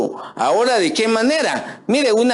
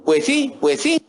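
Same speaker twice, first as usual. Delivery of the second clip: higher, lilting "Pues sí" with rising ends; mock local sing-song; 7 semitones higher than usual. Preacher mocking his people's sing-song phrase, higher and lilting. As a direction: tease our own cantadito by singing "pues sí". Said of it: "nosotros tenemos una frase".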